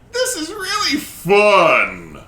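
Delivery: put-on high, slow child's voice; cheerful creepy kid; ghost child voice.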